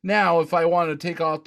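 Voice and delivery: ordinary talk, nothing marked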